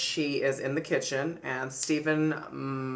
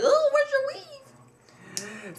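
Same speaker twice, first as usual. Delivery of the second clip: much higher, slow, hammed-up surprised voice; mock gossip voice; 4 dB louder, much slower than usual. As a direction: play a nosy onlooker, high and slow. Mock voice of an onlooker.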